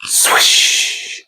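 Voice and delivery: usual speaking voice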